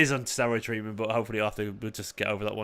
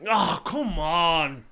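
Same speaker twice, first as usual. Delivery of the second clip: long, drawn-out, higher wail of frustration; exasperated groan; 5 semitones higher, much slower than usual. Real, not acted. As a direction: groan at your team, stretching every word slowly. Team lets him down on stream.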